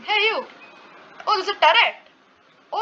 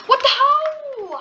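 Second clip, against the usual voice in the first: shocked laughing; 12 semitones higher, 7 dB louder, much slower than usual. Real, not acted. Suddenly knocked off.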